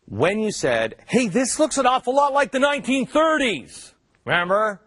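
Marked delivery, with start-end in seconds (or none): 1.1-3.9 high-pitched imitated warning; mock alarm. Quoting your own mocked warning, high mock alarm. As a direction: exaggerate it, pitched well up.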